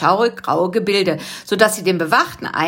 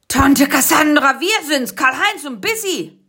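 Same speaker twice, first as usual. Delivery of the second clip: calling out; 8 semitones higher than usual. Acted. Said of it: "rief Karl-Heinz".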